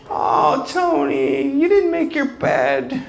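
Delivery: high, put-on mom voice; lightly voiced disappointed mother; mom scolding. Mom chiding her grown son, in a high mock voice. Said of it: "And my mom says".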